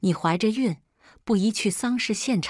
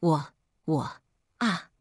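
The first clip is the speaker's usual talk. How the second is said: ordinary delivery, nothing marked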